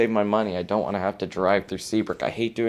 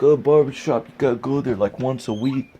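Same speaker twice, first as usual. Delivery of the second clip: mocking dad; 5 semitones higher, 6 dB louder than usual. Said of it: "but he's like".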